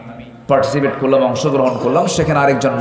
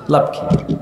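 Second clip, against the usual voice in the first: whisper; 3 dB quieter, slower than usual. Acted. Hushed rhetorical question mid-sermon.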